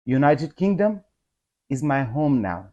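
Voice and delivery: ordinary talk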